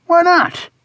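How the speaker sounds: very high, incredulous question; indignant friend. A narrator's reading. Friend refused a trip to the bar, indignant. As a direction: shoot the question up high in protest.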